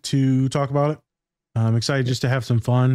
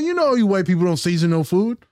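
sassy retort; 6 semitones higher than usual. Retold or imitated. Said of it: "I'm like".

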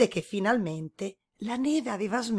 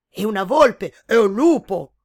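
animals answering in turn; 2 semitones higher, 12 dB louder than usual. A narrator's reading. Fox and wolf answer.

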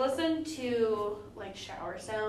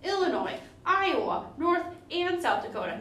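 louder, higher announcer read; podcast intro; 4 semitones higher, 6 dB louder than usual. Acted. Hosts greeting listeners, upbeat announcer read. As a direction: open the show like a host.

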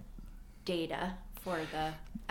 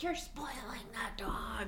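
hushed whisper, higher pitch; 5 semitones higher, 4 dB quieter than usual. Retold or imitated. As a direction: whisper it like a scolding. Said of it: "He's like".

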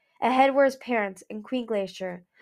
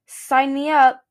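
loud, cheerful sign-up; eager volunteer; 4 semitones higher, 11 dB louder, slower than usual. A narrator's reading. Kibble volunteers for the quest, loud and eager. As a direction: jump at the chance.

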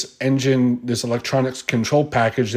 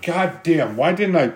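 normal talking voice

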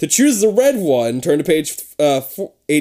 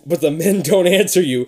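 ordinary delivery, nothing marked

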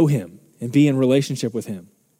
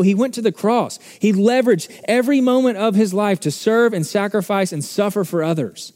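higher, intense preaching; 6 semitones higher than usual. Acted. Pastor challenging churches that sell prosperity, intense preaching. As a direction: challenge the prosperity message head-on. Continues preaching, still worked up.

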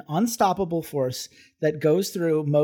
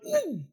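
high, soft wincing ooh; a sympathetic ouch sound, much higher; pained ooh; 5 dB quieter than usual. Retold or imitated. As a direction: wince as if you felt the hit. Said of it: "I literally went".

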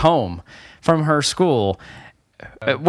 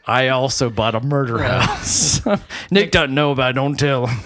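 low confiding dad voice, amused; Nick's dad impression; 8 semitones lower than usual. Dad admitting he bought a murder house, low voice. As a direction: play a dad in a much lower register. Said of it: "That's exactly what he sounds like".

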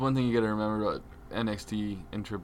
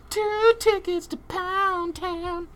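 squeaky high read; much slower than usual. Acted. Reading final crude hashtag.

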